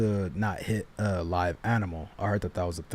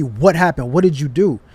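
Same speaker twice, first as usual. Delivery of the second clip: loud, higher-pitched angry questioning; pissed off; 4 semitones higher, 9 dB louder than usual. Retold or imitated. Car owner confronting him after the crash, pissed off. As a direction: imitate her demanding answers, angrily. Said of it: "So she's pissed off".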